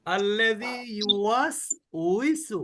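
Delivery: very slow ইউওয়াসউইসু with a rounded, lip-spread উই; teacher models the correct reading for students; correct recitation. Shown as a demonstration. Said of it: "সবাই বলি".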